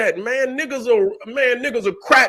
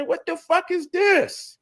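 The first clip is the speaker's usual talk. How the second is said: retold disbelief; 8 semitones higher, 3 dB quieter than usual. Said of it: "And I say".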